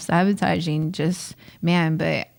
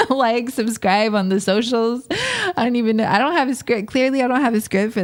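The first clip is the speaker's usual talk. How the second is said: higher voice, just out of a laugh; amused; 5 semitones higher than usual. Real, not acted. Host admitting to listeners there's no script, amused. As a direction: say it through leftover laughter, pitched up.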